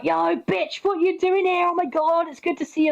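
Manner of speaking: high, bright mock hello to a cousin; excited greeting. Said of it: "we'd be like".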